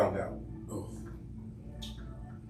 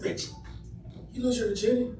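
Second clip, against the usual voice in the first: mama voice; 11 semitones higher, faster than usual. Retold or imitated. Mother voiced.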